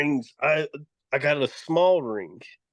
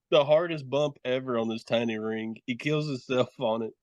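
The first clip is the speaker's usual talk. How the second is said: ordinary talk, nothing marked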